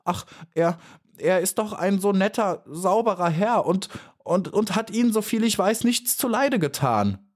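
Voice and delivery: high, halting stammer; stammering helplessly. A narrator's reading. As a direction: stumble over the words, helpless. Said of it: "Maya stotterte hilflos".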